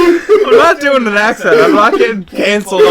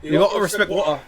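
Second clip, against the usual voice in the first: softer, badly faked British-Australian accent; understated fake British-Australian voice; put-on accent; 8 dB quieter than usual. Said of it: "make fun of my accent".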